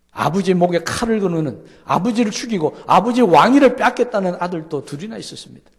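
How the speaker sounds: loud, high, ringing climax of the sermon; fervent preaching. Real, not acted. Pastor crying out God's promise to the congregation, loud and high. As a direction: proclaim it at full voice to the whole room.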